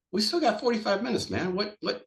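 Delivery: high, exasperated retold protest; imitating his own protest over the siren; indignant protest. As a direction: push back like the rule is absurd. Arguing with cart staff at siren.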